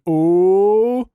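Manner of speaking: loud, rising mock ooh; a chorus of impressed ooh; group ooh. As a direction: ham up the crowd's ooh. Whole voice chat reacts.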